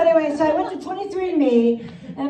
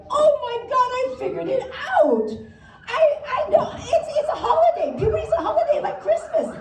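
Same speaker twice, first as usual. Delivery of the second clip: much higher, bubbling with delight; a giddy little girl making a big discovery; girlish excitement; 12 semitones higher than usual. Retold or imitated. Young [name] answers her mother about puberty, giddy and excited.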